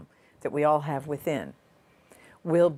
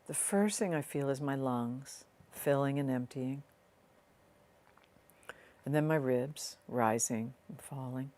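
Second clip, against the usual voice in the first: soft, unhurried meditation voice with long pauses; guided meditation; 2 semitones lower, 5 dB quieter than usual. Real, not acted. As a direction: ease the listener gently inward, leaving space between phrases.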